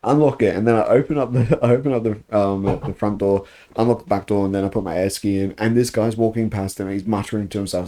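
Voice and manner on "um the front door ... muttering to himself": ordinary talk, nothing marked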